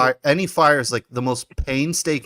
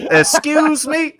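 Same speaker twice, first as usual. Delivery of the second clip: much higher, slow mock-sassy excuse me; sassy comeback; 4 dB louder, much slower than usual. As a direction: stretch it out like an offended diva. Mocking sassy Nala comeback.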